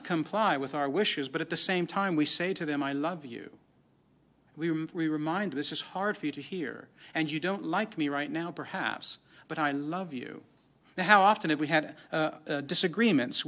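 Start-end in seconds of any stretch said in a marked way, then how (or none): 2.8-3.5 soft, gentle parental I love you; tender reassurance. Pretend. Parent tells child lovingly. 5.6-10.4 put-on softer, gentle words to an upset child; tender reassurance. Parent reassuring child in conflict.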